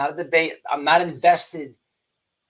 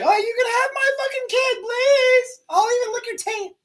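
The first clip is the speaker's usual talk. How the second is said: mock sobbing plea. Mocking her offering anything in jail, falsetto crying shout. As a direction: overdo the desperate pleading. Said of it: "And then she's like".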